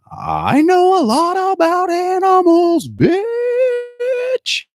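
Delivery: slow, very high screeching yell; imitated kid's yell. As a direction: stretch it out like a small child yelling. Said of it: "just to hear her yell".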